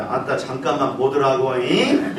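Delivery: Jeolla dialect with a laugh at the end; lightly voiced Jeolla dialect, amused. Shown as a demonstration. 전라도 사투리로 웃으며. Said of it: "전라도는 이렇게 말한답니다".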